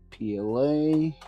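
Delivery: usual speaking voice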